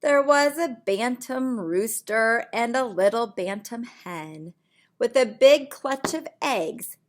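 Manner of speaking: lively storybook voice for little ones; reading to children. Acted. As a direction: read it like bedtime story time. Reads picture book aloud.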